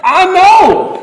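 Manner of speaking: high, loud exasperated shout back; frustrated yell. Retold or imitated. Frustrated dad answers his yelling son, shouting. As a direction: holler it back, fed up. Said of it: "would frustrate me so much".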